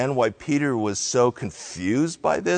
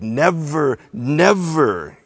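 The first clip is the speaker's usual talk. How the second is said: "Never, never" as two separate, higher punches; very emphatic; 3 semitones higher, 4 dB louder, slower than usual. Retold or imitated. Peter's double negative to Jesus, very emphatic. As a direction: hit each "never" firmly, falling at the end. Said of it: "he's very emphatic".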